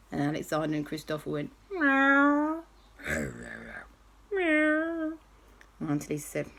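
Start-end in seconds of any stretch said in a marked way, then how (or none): 1.7-2.6 high, rising cat meow; playful meow. Acted. Boys answer aunt with animal noise. 4.3-5.2 high meow, quieter and falling; a second, gentler kitten meow; softer meow. Acted.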